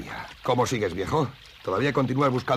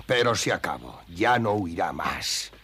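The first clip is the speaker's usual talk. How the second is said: normal talking voice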